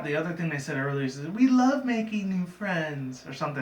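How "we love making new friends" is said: high, slow, stretched-out line; drawn-out old cartoon girl voice; cartoon girl imitation. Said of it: "sounded like the girl from Baz".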